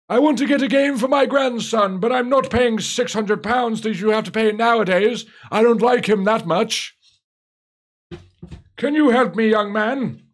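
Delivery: put-on comic old codger voice; old guy voice. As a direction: play a chuckling old man asking for help. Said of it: "I can do a better old guy accent".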